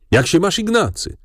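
high-pitched exclaimed hello; hearty called greeting. A narrator's reading. Franz falling into his friend's arms, high exclaimed greeting. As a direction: call out a greeting, voice lifted high. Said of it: "zawołał, padając mi w objęcia".

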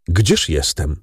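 usual speaking voice

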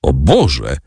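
plain reading voice

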